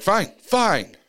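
a retold high, loud reluctant fine; exasperated giving in. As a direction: throw up your hands and give in. Gives in again, retold.

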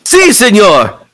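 reply like a keen sidekick; Spanish-accented sidekick. Acted. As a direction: Alejandro answering his boss with a quick yes. Alejandro answers his boss.

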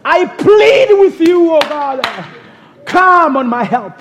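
normal talking voice

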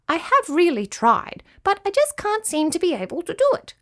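high, small pleading voice; high-pitched admiring little creature; little cricket Charlie, earnest. Acted. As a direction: confess earnestly as a tiny young cricket.

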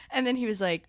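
usual speaking voice

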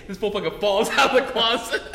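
ordinary delivery

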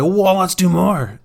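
high, drawn-out dog voice begging to continue; eager dog. Acted. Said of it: "your dog's just like".

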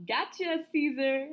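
loud, high, laughing tease. Real, not acted. Teasing Caesar over the L A slip, laughing loudly. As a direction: giggle it out, pleased with the joke. Laughing at her own prank.

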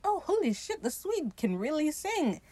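high, hushed amazement; mock awe. Crew hearing the Swede sing like an angel, mock awe. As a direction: marvel softly in a high voice.

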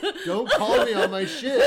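high loud laughing. Real, not acted. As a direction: laugh while pleading. Laughing plea.

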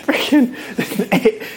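high, squeaky through laughter; laughing. Real, not acted. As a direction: giggle it out. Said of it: "You're laughing way too hard".

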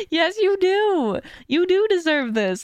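high, drawn-out emphatic insistence; insistent reassurance. Retold or imitated. Friend answering her self-doubt on the phone, insistent reassurance.

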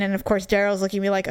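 normal talking voice

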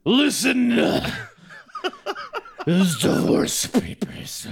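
slow, gruff mock Triple H; hammed-up wrestler growl. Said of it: "Vince and Triple H just have the same voice".

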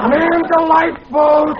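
high panicked yell; panicked shout. Acted. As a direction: cry out in alarm. Panicked cry in the boat.

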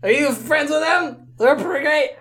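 high, bubbly tipsy halfling; cheerful halfling voice. Acted. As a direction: chirp it out like a giddy drunk.

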